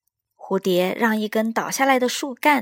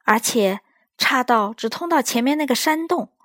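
higher, louder flustered elephant; worried Elmer; 4 semitones higher, 6 dB louder than usual. Acted. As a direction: think aloud, growing anxious. Elmer stuck on narrow path.

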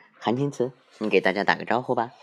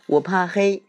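little bear voice; 9 semitones higher, 5 dB louder than usual. Acted.

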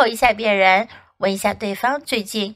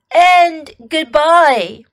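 slow, louder croaky-free frog voice; slow frog goodbye; 5 semitones higher, 8 dB louder, slower than usual. Acted. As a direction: drag out a sleepy goodbye. Frog says goodbye slowly.

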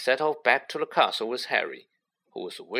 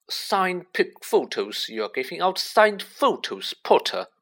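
raised, scathing taunt; loud and scathing; 6 semitones higher, 4 dB louder than usual. A narrator's reading. Malfoy mocking Harry across the courtyard, scathingly. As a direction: jeer scornfully, dropping on the name. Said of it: "Loud and scathing".

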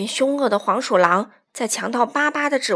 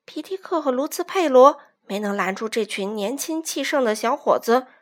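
plain reading voice

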